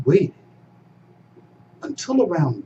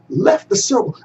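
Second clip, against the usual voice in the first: ordinary delivery, nothing marked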